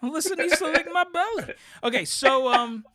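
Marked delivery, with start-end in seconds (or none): 0.0-1.5 higher, hammed-up eager voice; mock inner thought. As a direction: jokingly voice your own eager inner thought. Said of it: "I'm like".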